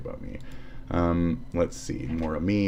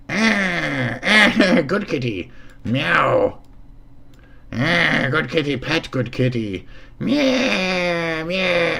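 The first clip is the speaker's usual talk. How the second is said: high cooing pet talk with meows; talking to a kitty; 9 semitones higher, much slower than usual. Pretend. Streamer cooing at a cartoon cat, high pet voice. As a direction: coo at it like a pet owner.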